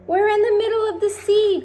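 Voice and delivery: very high, loud alarmed cry; cried. A narrator's reading. James tells the others they are at sea, alarmed cry. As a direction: blurt out the shock. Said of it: "cried James".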